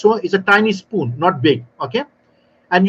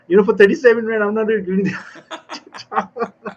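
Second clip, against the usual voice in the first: tearful complaint; 3 semitones higher than usual. Retold or imitated. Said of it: "she almost have a tears on her eyes".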